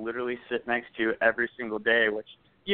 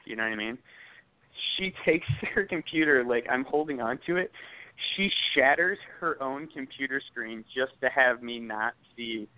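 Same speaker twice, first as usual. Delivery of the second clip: ordinary talk, nothing marked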